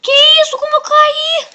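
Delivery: high loud shocked yelp; shocked cry. Real, not acted. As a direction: cry out in disbelief. Shock after falling in game.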